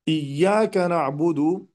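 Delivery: "Iyyaka na'budu" drawn out, doubled ya pressed; slow tajwid recitation. Shown as a demonstration. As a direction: recite it slowly, letter by letter. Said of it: "hakadha ya Syekhina".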